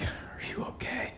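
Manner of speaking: barely whispered, airy question; hushed concern. Retold or imitated. Dad checking on his injured son, hushed whisper. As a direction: whisper it to your hurt child. What dad really said, versus heard.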